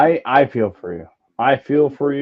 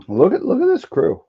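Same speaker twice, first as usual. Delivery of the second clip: excited; 13 semitones higher, faster than usual. Real, not acted. Hyping up the co-hosts.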